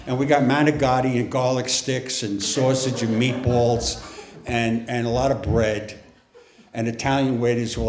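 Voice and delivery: ordinary talk, nothing marked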